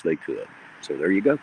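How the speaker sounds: usual speaking voice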